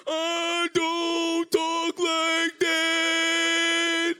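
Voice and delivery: very high, loud, endlessly stretched words; grotesquely slow, high-pitched mockery of a boyfriend; mocking his voice. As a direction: drag it out in a shrieking mimic. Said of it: "You got me sounding like Lil Peter from Us".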